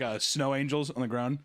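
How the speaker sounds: ordinary talk, nothing marked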